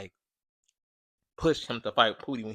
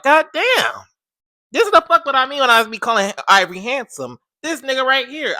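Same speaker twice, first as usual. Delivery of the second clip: loud, higher hyped outburst; excited exclaim; 12 semitones higher, 11 dB louder than usual. Retold or imitated. Calling Katari handsome to viewers, loud hype. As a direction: hype it up like proof. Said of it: "And I was like".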